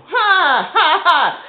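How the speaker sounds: joyful ha ha. Real, not acted. Cries out in delight.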